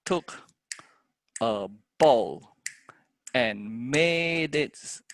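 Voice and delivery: very slow metered chant, syllables held on the beat; poem in three. Shown as a demonstration. Teacher trying to fit a meter to verse, slow measured recital. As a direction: count it out in three, stretching stressed syllables. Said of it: "maybe in three".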